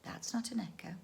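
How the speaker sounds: frightened whisper; hushed, scared realization. Acted. Children whispering in fright to each other.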